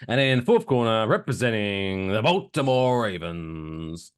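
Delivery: drawn-out final introduction; big ring-announcer build. Acted. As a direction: stretch the name slowly like a fight announcer. Ring announcer introducing last fighter.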